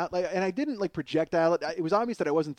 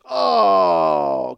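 disgusted groan; 13 dB louder than usual. Retold or imitated. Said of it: "everybody just goes".

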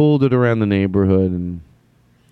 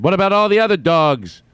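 higher-pitched mock outcry; indignant protest; 9 semitones higher, 4 dB louder than usual. Imagined parent objecting to a kid's plea, indignant outcry. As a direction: protest loudly on behalf of every dog.

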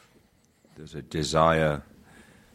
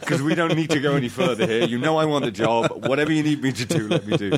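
laughing, high, eager rush to grab the job; laughing eagerly; 10 semitones higher, 4 dB louder than usual. Retold or imitated. Actor pleading with the director for the job, laughing. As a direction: laugh through it, desperate to be cast.

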